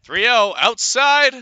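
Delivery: excited call. Real, not acted. Calling pitch loudly.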